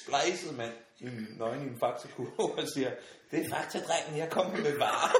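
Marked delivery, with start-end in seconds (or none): 3.3-5.1 raised, louder voice, breaking into laughter; giggling mock announcement; laughing prank quote. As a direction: deliver the line while cracking up. Friend's prank at her door.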